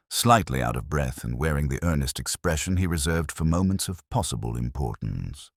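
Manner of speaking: ordinary delivery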